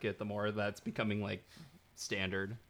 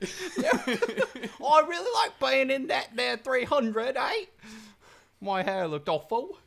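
high-pitched mock Cockney, drawn out; Lena Headey; 14 semitones higher, 4 dB louder, much slower than usual. Mocking an actress discussing her film, hammed-up Cockney. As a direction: ham up a giant Cockney accent. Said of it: "with a giant Cockney accent".

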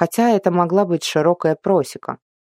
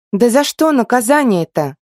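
high, loud indignant protest; 8 semitones higher, 5 dB louder than usual. A narrator's reading. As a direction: protest loudly, outraged. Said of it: "возмутилась Арина".

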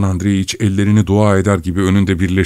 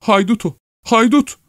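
high woman's outcry; alarmed wife. A narrator's reading. Wife calling the intruder a bandit, high alarm. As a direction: exclaim in a very high pitch.

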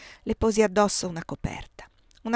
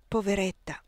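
ordinary delivery

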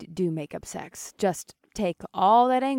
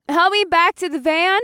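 deafened yell; 10 semitones higher, 8 dB louder than usual. A narrator's reading. Deafened woman asking help.

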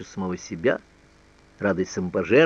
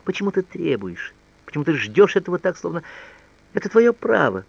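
higher-pitched, pleading reproach; мысленный упрёк; 8 semitones higher than usual. A narrator's reading. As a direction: question the lover with rising insistence. Annette's silent reproach to lover. (взволнованный упрёк высоким голосом)

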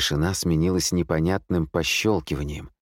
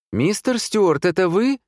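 high-pitched, louder female voice; woman's voice; 13 semitones higher, 5 dB louder than usual. A narrator's reading. Woman calling from the dark, high female voice. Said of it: "раздался женский голос".

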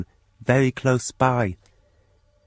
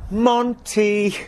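the unseen neighbour's cat call, much higher and cheerful; imitating the stranger's call; 10 semitones higher, 3 dB louder than usual. Mysterious neighbour aping his call, high and carefree. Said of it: "I heard somebody say".

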